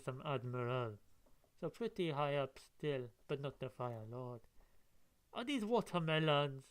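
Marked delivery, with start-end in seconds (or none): none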